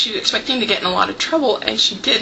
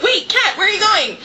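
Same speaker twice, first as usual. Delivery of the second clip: high-pitched retold call to the cat; raised-pitch quote of a bewildered kid sister; puzzled sister; 9 semitones higher than usual. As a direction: voice a sister calling after her cat, high.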